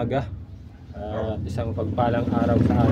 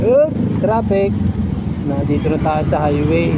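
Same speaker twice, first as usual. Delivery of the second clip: raised voice; 5 dB louder than usual. Real, not acted. Riding on highway in traffic.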